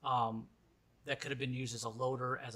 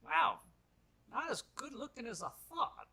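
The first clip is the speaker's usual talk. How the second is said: high, lightly voiced old man; a hint of a fussy old gentleman; old miner's voice; 12 semitones higher than usual. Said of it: "he just goes".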